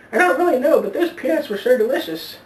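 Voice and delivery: put-on high, thin old lady voice, a bit quick; old woman voice.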